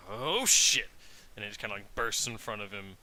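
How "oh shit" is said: loud, alarmed "Oh, shit!" from a surprised monster; startled yell. Acted. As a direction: blurt it out loud as the fire bursts in its face. Monster reacts to sudden flame.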